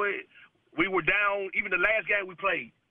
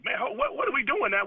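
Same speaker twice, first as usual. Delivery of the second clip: higher-pitched mock disbelief; retold bewilderment; 9 semitones higher than usual. Player retelling a sluggish morning game, mock bewildered. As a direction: quote the team's baffled grumble, pitched up. Team's bewildered reaction, acted out.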